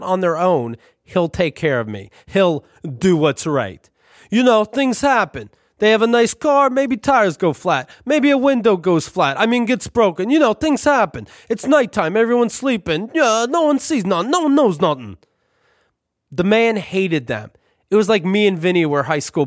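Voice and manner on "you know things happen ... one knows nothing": put-on higher, heavy Brooklyn-Italian landlord accent; sly Italian landlord hinting at mischief; thick Italian accent. Landlord slyly suggests vandalism to tenant, thick Italian accent. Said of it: "in his thick Italian accent".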